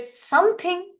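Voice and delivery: "something" lifted high and leaned on; stress on something. Shown as a demonstration. Speaker showing the class how a key word is stressed, "something" lifted high and leaned on. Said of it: "I emphasized on the word something".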